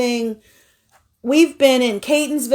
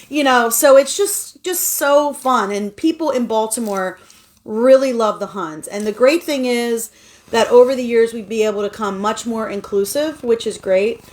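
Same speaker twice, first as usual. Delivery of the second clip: ordinary delivery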